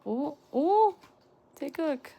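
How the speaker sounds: high, loud excited yelps; excited squeal. Real, not acted. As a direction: burst out with delight. Reacting to Taekook photocard.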